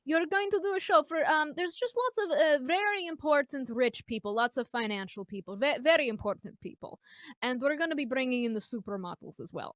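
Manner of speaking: hammed-up Russian maitre d'; horrible Russian accent. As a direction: put on a bad Russian accent. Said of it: "apologies for my horrible Ru-, Russian accent".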